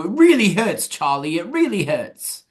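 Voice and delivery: high-pitched mock British wail, last words drawn out; thick British accent. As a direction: quote it in an exaggerated British accent. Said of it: "in, like, a thick British accent".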